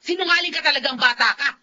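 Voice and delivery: loud angry rebuke; angry scolding. Acted. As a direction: tell her off hard, no patience. Grandmother scolding granddaughter. (galit na pagsaway sa apo)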